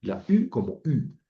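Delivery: U said both times as rounded ee; each U halfway between i and u; Greek upsilon sound. Shown as a demonstration. Teacher urging students to say U as rounded i. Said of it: "es mejor que pronuncien la U como U".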